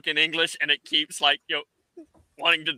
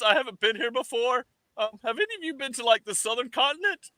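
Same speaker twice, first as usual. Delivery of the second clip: much higher, chirpy drop-in voice; Kevin popping in; 9 semitones higher than usual. Acted. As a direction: breeze in high and cheerful, like a visitor. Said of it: "I think Kevin's just gonna, like, pop in one day".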